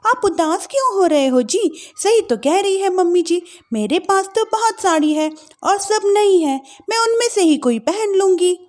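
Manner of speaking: soothing her husband lightly; young wife voice. Acted.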